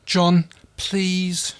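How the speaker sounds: high, pleading "John, please"; Aaron pleading with Crichton, high and tearful. Acted. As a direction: beg him to stop.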